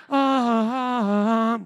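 long, loud held angelic "ahh"; mock heavenly "ahh". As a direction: intone a reverent choir note in jest. Mimics heavenly glow of journal.

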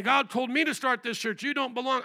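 ordinary delivery

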